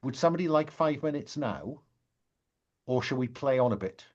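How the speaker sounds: ordinary talk, nothing marked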